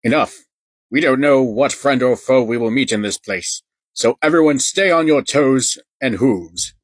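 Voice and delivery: rally the troops boldly; commanding hero voice. Acted. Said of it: "said Duke Danger".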